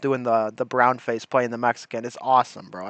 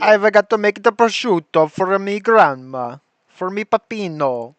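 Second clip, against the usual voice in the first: hammed-up Italian immigrant accent, pitched up; mock Italian accent; 4 semitones higher than usual. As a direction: copy the Italian accent of the film's sweeper. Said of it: "I love that accent".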